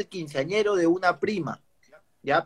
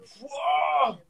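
long, high retching whoosh; drawn-out mock puke noise; imitated vomiting; 9 semitones higher than usual. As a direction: act out a big gush of vomit. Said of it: "le mandé una búsqueda, pe".

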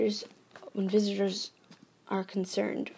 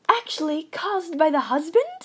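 higher, urgent exclamation cutting in; cried out; 8 semitones higher, 6 dB louder than usual. A narrator's reading. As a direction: cut him off eagerly. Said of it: "I cried".

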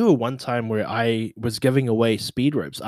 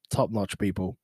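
usual speaking voice

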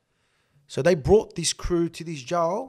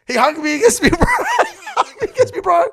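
laughing high through the words; 10 dB louder than usual. Real, not acted. Laughing as he tells the hug. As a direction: crack up while telling it.

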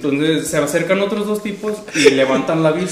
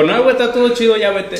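louder, higher hurried reassurance; brushing off; 6 semitones higher, 5 dB louder than usual. Retold or imitated. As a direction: wave him off, insisting all is fine.

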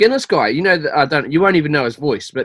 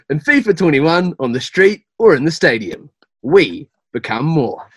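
ad read; 3 semitones higher, 3 dB louder than usual. Pretend. Voice actor repeats the uncaught line, projected ad read. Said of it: "we didn't quite catch that".

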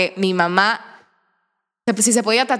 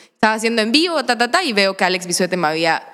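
ordinary delivery

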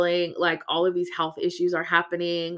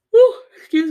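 yawning; 14 dB louder than usual. Real, not acted. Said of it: "Forgive me for the yawns".

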